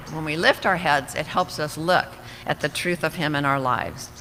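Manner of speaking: normal talking voice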